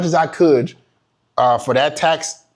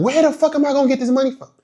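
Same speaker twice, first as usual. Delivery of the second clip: much higher, fast panicked question; frantic; 10 semitones higher, faster than usual. Retold or imitated. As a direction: race through it in a panic. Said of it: "I'm frantic".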